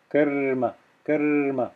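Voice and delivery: karma with the r held a little longer before ma; extended r. Shown as a demonstration. Teacher showing students the conjoined consonants, extended r in karma. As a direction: stretch the r in karma. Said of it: "I'm adding a little bit extra".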